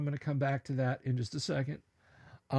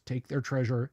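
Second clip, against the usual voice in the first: ordinary talk, nothing marked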